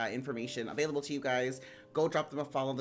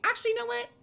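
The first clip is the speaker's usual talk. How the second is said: excited high voice; 6 dB louder, faster than usual. Real, not acted. Sudden change of plan.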